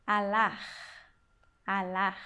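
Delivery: Alar said low and carefully, twice over; Hebrew pronunciation demo. Shown as a demonstration. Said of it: "e a terceira palavra".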